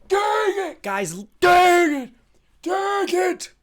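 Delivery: high, crying shout; anguished cry. Real, not acted. Streamer crying out to friends after another miss, anguished. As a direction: wail at the loss. Wrong answer again.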